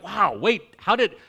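baffled Pharisees. Retold or imitated. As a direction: sound thrown and confused mid-argument. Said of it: "and they're just like".

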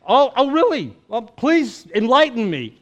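feigned interest, tongue in cheek; sarcastic Jesus. Retold or imitated. Jesus answering Cleopas's insult, playfully sarcastic. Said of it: "he just, like, goes".

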